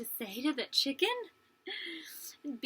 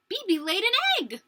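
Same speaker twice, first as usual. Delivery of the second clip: high, loud thrilled exclamation; amazed announcement; 9 semitones higher, 7 dB louder than usual. Acted. Child narrator reporting the hen's first egg, amazed announcement. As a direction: announce it as astonishing news.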